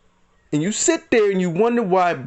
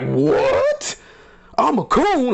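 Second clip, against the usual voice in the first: mock disbelief, rising into a higher-pitched question; incredulous retort; 7 semitones higher, much slower than usual. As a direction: act out a man stunned by an insult. Retold man's stunned reply to insult.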